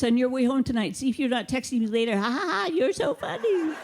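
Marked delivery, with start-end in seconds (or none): 2.2-3.7 sarcastic laugh. Acted. Imagined sarcastic text reply.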